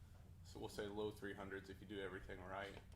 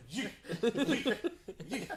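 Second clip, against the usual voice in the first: playful yeet; 13 semitones higher, 11 dB louder, much slower than usual. Pretend. Mimicking blower flinging kids.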